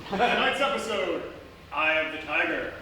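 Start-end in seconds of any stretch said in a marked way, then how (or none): none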